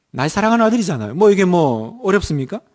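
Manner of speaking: usual speaking voice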